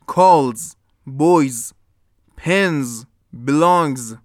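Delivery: put-on "calls", "boys", "pens", "belongs", each ending in a buzzed z; final voiced z. Shown as a demonstration. Teacher gives examples of the z ending, buzzed final s.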